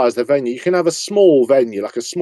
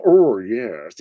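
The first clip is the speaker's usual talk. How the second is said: camp drawn out oh yes; Kenneth Williams. Acted. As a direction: vamp it up like a Carry On star. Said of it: "someone like Kenneth Williams".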